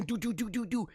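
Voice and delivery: rapid pulsed "do" noises; imitated money gun. Host describing money shot from a machine, imitated firing. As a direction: fire off little staccato shots by mouth.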